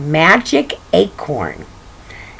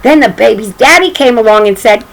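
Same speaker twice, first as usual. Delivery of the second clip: ordinary delivery, nothing marked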